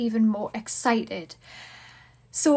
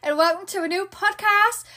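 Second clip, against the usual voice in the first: excited, raised-voice show opening; 5 semitones higher than usual. Real, not acted. Podcast host greeting listeners with the house to themselves, voice raised. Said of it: "my voice is raised".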